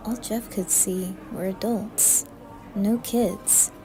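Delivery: plain reading voice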